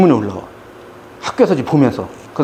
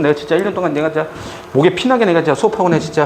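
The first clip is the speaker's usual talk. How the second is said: ordinary talk, nothing marked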